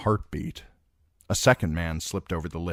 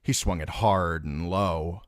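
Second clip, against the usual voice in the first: normal talking voice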